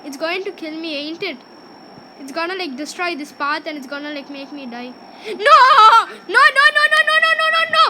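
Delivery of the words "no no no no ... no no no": loud, high string of no's; high-pitched shouted protest; panicked game shout. Real, not acted. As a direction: yell it rapid-fire as your game character dies. Dying in the game.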